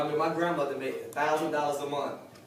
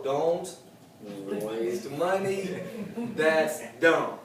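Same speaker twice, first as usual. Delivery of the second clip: said slow; much slower than usual. Shown as a demonstration. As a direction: spell out "Don't waste money" word by word, slowly. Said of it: "I'll say it slow".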